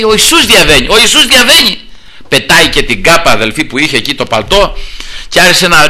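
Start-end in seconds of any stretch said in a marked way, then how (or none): 0.0-1.8 excited announcement. Acted. Crowd announces Jesus is passing.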